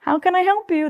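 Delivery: a touch of put-on front-desk pitch; hotel greeting.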